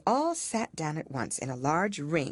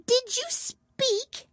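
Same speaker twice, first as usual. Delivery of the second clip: high, slow, stiffly polite mouse voice; icy courtesy from a small mouse; frowning but polite; much slower than usual. A narrator's reading. Mouse to the lory, frowning yet polite. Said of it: "frowning, but very politely".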